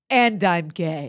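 slow, low dramatic pause-laden confession, laughing through it; dramatic reveal. Retold or imitated. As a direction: drop it like a big reveal. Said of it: "our man Luigi goes".

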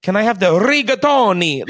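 high mock Italian, hard-trilled R in rigatoni; overdone Italian accent. Shown as a demonstration. Host mocking tourists who overdo it, hammed-up Italian accent. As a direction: go overboard on Italian rigatoni, big rolled R. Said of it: "I don't think you need to go".

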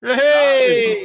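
high, loud welcome shout; excited greeting. Real, not acted. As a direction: greet him with a big cheer. Greeting guest loudly.

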